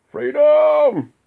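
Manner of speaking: huge high roaring shout of Freedom; Braveheart battle cry. Retold or imitated. Imitating Braveheart's war cry for his listeners. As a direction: bellow it like a rallying war cry.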